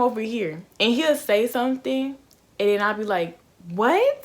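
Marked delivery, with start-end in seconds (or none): 3.7-4.2 loud, high-pitched irritated question; annoyed retort. Retold or imitated. Woman retelling her reply to mumbling date, annoyed. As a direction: retell it as a sharp, raised "what". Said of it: "It was getting so annoying".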